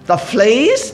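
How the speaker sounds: loud, rising disbelief; can't believe it; incredulous. A narrator's reading. Corrie balks at thanking for fleas, incredulous.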